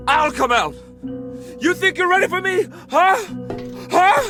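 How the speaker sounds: very high, loud yelled threats; hysterical shouting. Acted. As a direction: shout them off in frightened fury. Armed, yelling threats at visitors.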